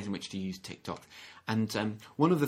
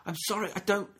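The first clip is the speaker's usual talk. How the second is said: put-on flustered child; 8 semitones higher than usual. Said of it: "And they're going".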